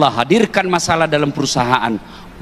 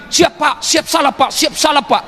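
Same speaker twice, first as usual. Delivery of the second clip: mock subordinate; 7 semitones higher than usual. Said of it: "di kantor kita dimaki-maki".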